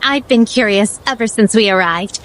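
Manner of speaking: usual speaking voice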